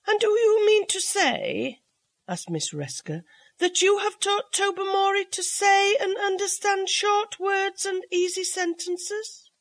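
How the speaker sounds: doubtful guest asking, pitched well up; Miss Resker's voice. A narrator's reading.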